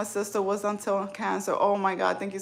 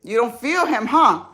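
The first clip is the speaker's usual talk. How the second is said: louder, higher teasing challenge; excited teasing call; 6 semitones higher, 8 dB louder than usual. Retold or imitated. Mom teasing her daughter after chemo, loud and playful. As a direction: loudly dare her to feel the tumor. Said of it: "you'll be like".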